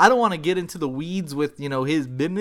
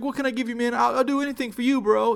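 eager server; 8 semitones higher, faster than usual. Retold or imitated. Eager server voiced in story.

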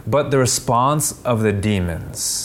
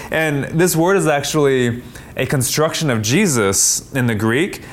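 usual speaking voice

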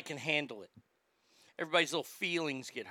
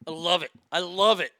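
louder with each I love it; growing delight; 9 dB louder than usual. Real, not acted. Host delighting in the joke, getting louder. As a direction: build the glee up line by line.

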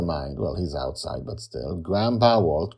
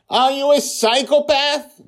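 very high, loud accusing shout; 10 dB louder than usual. A narrator's reading. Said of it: "Justin is shouting".

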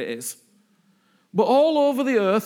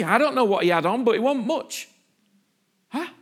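ordinary delivery

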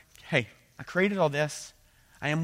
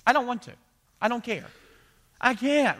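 higher, louder mock whine of a child; whiny kid; 8 semitones higher, 4 dB louder than usual. Pastor mimics a child refusing to clean their room, whining. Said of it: "when your kids tell you".

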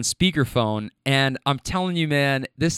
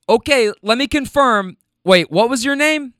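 higher, louder confirming voice; acted caller breaking off to check a detail; man on phone; 8 semitones higher, 5 dB louder than usual. Friend confirming details mid phone call.

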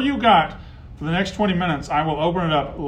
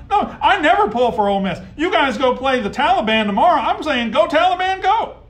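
high, loud laughing jab; rising mockery to a high yell; laughing rant; 11 semitones higher, 6 dB louder than usual. Real, not acted. Host mocking rival fans, loud and laughing.

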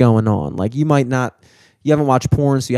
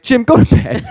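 louder, high-pitched mock dad command; mock parental order, pitched way up; imitated dad's order; 11 semitones higher, 5 dB louder than usual. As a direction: send the kid off, imitating a flustered dad. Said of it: "my dad was like".

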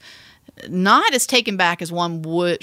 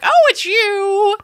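high, slow, louder drawn-out surprise; recognising him; 12 semitones higher, 5 dB louder, much slower than usual. Retold or imitated. The woman seeing the pilot emerge, high and slow. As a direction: stretch it out as she realises.

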